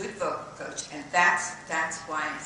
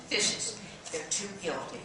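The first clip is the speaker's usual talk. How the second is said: ordinary talk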